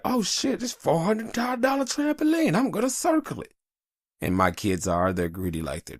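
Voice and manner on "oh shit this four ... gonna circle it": put-on high, eager kid voice; imitating his excited kid. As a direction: mimic a greedy kid spotting a treat. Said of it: "Rain's gonna be like".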